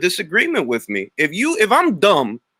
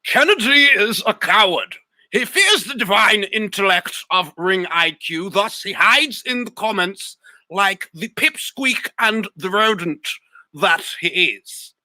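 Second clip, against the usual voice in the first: mock grandiose proclamation; 3 semitones higher than usual. Streamer mocks the troll with a hammed-up grand decree. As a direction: declare it like a self-important sage.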